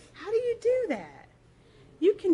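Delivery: high, amazed coworker voice; curious coworker. Retold or imitated. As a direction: gush like a fascinated colleague.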